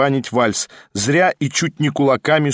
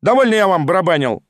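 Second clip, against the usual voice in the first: higher, mildly shouted complaint; exclaimed protest; 6 semitones higher, 3 dB louder, faster than usual. A narrator's reading. Lyamshin refuses the hostess any more piano, crying out. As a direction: refuse with an indignant cry. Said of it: "крикнул Лямшин".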